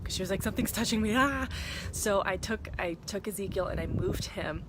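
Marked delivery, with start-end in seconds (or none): 0.4-1.5 mock frightened yelp, rising to a louder cry; freaked out. Mother retelling her toddler's fright, mock freaked out. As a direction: imitate a startled toddler's squeal. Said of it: "she just got freaked out".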